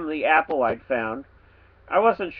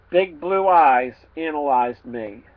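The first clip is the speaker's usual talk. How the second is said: ordinary delivery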